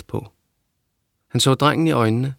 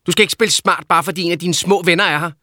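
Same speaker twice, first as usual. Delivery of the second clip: mother's voice raised in a quarrel; angry mother; 7 semitones higher than usual. A narrator's reading. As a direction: snap back at her son.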